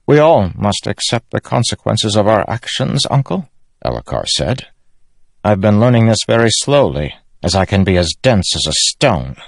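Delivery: earnest, self-critical royal nephew; resolved young king. Acted. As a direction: own up to it before your uncle. Narrator voices Elhokar.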